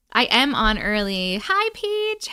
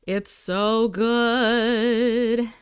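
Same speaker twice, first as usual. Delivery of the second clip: slow drawn-out savouring; much slower than usual. Real, not acted. Eating on her live, slow drawn-out savouring. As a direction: stretch every word very slowly, lingering on good.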